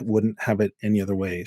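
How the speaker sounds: normal talking voice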